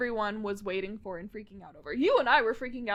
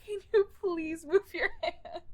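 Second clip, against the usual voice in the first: flustered plea; 5 semitones higher than usual. Retold or imitated. Retold flustered plea to move hand.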